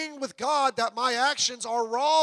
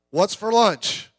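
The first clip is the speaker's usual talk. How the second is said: louder, slow, drawn-out "What's for lunch?" with a falling end; mock churchgoer; 8 dB louder, slower than usual. Preacher mocking churchgoers after service, loud and slow.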